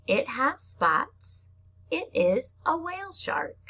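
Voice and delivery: slow, drawn-out storybook read; reading to children. Acted. Teacher reading a shark book to her class, slow storybook voice. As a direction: read each short line slowly for little kids. Reads picture book aloud.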